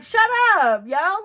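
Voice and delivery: mock-stern shutdown, pitch swooping up; stern command. Jesus silencing the evil spirits, sternly. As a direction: playfully imitate a stern order. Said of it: "he sternly commands them".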